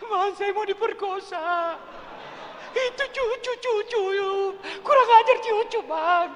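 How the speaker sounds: high, loud mock sobbing accusation; hammed-up crying complaint, high and loud; fake crying wife. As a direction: wail like a woman faking tears. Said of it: "namanya perempuan langsung acting".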